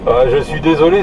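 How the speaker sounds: normal talking voice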